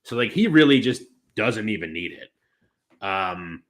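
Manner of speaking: ordinary talk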